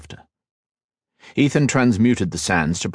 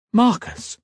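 high startled gasp of a name; gasped surprise. A narrator's reading. Kat startled to hear her friend's name, gasping. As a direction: catch your breath on the name. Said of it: "gasped Kat".

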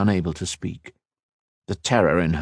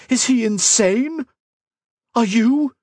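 high, indignant shout; outraged; 3 dB louder, slower than usual. Acted. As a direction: accuse her in outrage. Outraged accusation.

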